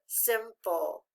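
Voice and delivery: "simple" slowly in two parts, sim-pull; measured "simple", each syllable distinct; syllable-by-syllable reading. Shown as a demonstration. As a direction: sound out "simple" with the end falling. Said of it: "All together".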